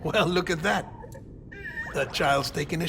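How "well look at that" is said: chuckling through the words; light laugh as he speaks; amused laugh. Acted. Baby Lou responds with a laugh.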